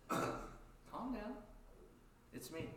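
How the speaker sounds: gently calm frightened friends; Jesus gently reassuring frightened disciples; calm reassurance. Acted. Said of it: "Jesus says".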